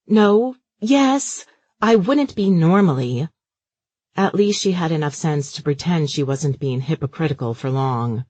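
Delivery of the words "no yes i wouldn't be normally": flustered. Acted. Mother caught phoning while driving.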